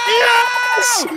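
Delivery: high, loud victory yell; shout of triumph. Real, not acted. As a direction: cheer the goal. Scoring celebration.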